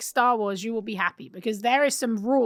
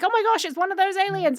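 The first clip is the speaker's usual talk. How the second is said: higher, quick retold astonishment; surprised reaction; 7 semitones higher, faster than usual. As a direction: replay a startled fan's reaction, pitched up and fast. Reenacts own surprised viewing reaction.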